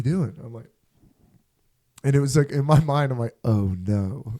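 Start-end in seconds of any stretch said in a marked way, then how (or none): 3.4-4.3 sinking dread. Retold or imitated. Inner dread at being caught.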